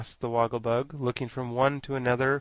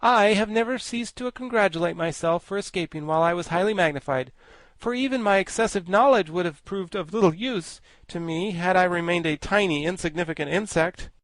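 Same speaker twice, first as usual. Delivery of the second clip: high, loud, grandly learned insect; high pompous bug voice; pompous Woggle-Bug; 7 semitones higher, 5 dB louder than usual. Acted. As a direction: lecture like a vain scholar bug.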